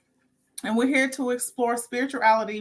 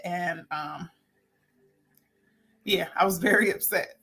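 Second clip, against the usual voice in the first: ordinary talk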